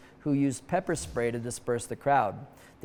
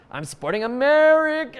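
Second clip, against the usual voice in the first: higher fan voice answering innocently; nervous fan; 10 semitones higher, 6 dB louder than usual. Pretend. Fan tells the guard his team, high and innocent. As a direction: play the fan naming the wrong team.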